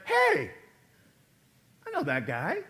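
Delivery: retold surprise; surprised hey. Recognizing the Levite's voice.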